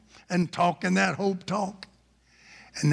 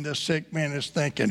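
ordinary talk, nothing marked

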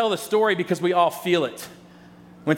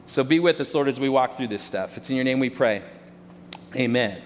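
opening prayer; 5 semitones lower than usual. Acted. Pastor praying over the congregation before the sermon, lower and hushed. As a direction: pray humbly over the room.

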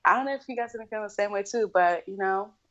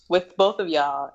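ordinary delivery

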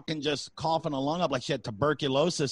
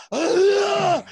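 high loud mock sneeze blast; imitated sneeze; 8 dB louder than usual. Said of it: "This is what it looked like".

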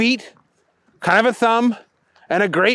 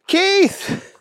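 high-pitched alarmed call; yelled name. A narrator's reading. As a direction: call out to your buddy in alarm. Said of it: "Booger yelled".

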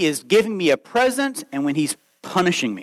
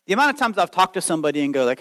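ordinary talk, nothing marked

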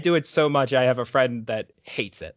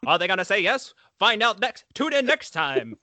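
higher teaser broadcaster voice; 6 semitones higher than usual. Pretend. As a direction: tease it like a TV host. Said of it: "as, like, a sports broadcaster".

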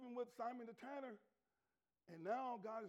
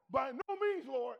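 Peter refusing; 9 dB louder, slower than usual. Acted. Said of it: "But Peter said".

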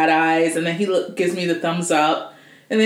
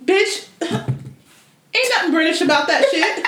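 sassy mockery; 10 semitones higher, slower than usual. Real, not acted. Mocking [name]'s fake accent.

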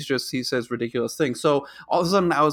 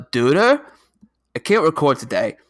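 best Homestar impression, pitched up and loud; 4 dB louder than usual. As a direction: read the text like a silly cartoon character. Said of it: "in my best Homestar".

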